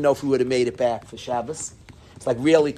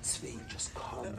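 urgent whisper; 21 dB quieter, slower than usual. Retold or imitated. Urging friend to get in van.

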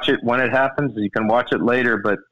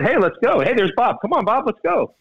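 higher, lively mock call; pros calling out; 9 semitones higher than usual. Teasing Bob about how the pros greet him, in a higher mock call. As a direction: wave a buddy over with it.

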